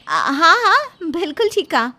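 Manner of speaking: high, loud, startled yes; flustered agreement. Acted. Put on the spot, agrees hastily. (हड़बड़ाकर ऊँची आवाज़ में हामी)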